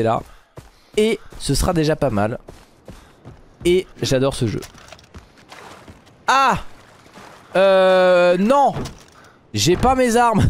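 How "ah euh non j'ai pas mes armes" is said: sudden panic over missing weapons; startled dismay. Real, not acted. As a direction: caught off guard, oh no. Realizes he has no weapons.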